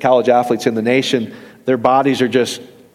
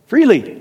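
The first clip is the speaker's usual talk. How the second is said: Freely said much higher on its own; single word Freely, pitched up and falling; stress on freely; 8 semitones higher than usual. Real, not acted. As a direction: lift the word Freely high and hold it. Preacher repeats key word emphatically.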